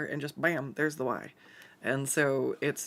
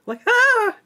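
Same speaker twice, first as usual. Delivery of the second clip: sudden pained yelp, much higher and louder; scalded yelp; 13 dB louder than usual. Retold or imitated. Recalling being scalded by her coffee pot, a sharp yelp. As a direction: cry out as if scalded by hot water.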